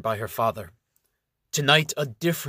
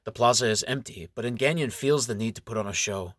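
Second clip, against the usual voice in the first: ordinary delivery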